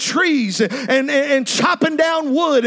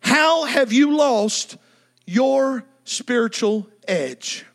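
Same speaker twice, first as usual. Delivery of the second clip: slow probing question; 3 semitones lower, slower than usual. Real, not acted. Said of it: "probing question".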